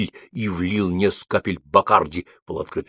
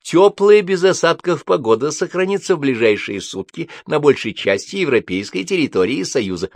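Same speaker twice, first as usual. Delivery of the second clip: lightly voiced weather announcer, raised pitch; диктор; 7 semitones higher than usual. A narrator's reading. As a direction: read it out like a broadcast forecast. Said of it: "произнёс диктор". (голос диктора прогноза погоды)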